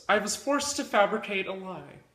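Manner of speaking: usual speaking voice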